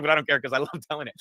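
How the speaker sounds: ordinary talk, nothing marked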